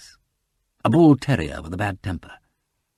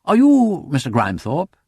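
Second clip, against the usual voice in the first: higher, louder courteous question; polite inquiry; 8 semitones higher, 6 dB louder than usual. A narrator's reading. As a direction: ask with a gentleman's good manners. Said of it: "he asked politely".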